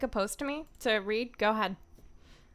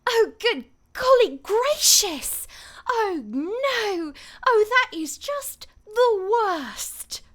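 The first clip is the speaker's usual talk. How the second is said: high, loud, drawn-out cry of dismay; theatrical high-pitched outburst; Tracer voice; 11 semitones higher, 7 dB louder, slower than usual. Acted. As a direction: wail it out slowly, dodging the swear words. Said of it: "Tracer, like, going really roundabout to not curse".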